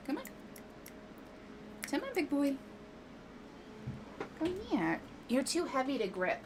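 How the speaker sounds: high cooing pet voice. Real, not acted. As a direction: coax the cat in a high pet voice. Baby talk to the cat.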